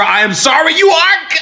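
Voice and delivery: very high shrieked "you are cooked"; mock falsetto shriek. To people who quit their jobs, a mock high shriek. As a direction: squeal the verdict in mock panic. Refrain at its peak.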